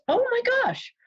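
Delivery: high-pitched gasp of delight; delighted surprise. Real, not acted. Psychic drawing her favorite card, delighted surprise. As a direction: exclaim it high, thrilled by the draw.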